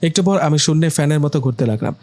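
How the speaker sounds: usual speaking voice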